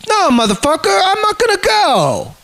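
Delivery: high-pitched, indignant retort; defiant refusal. Retold or imitated. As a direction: push the pitch up and refuse to budge. Retelling his refusal to cop.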